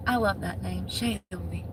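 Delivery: soft, lingering name; romantic. Pretend. Saying a viewer's name softly like a romance novel hero. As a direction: savor the name like a love novel. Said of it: "I make it so romantic".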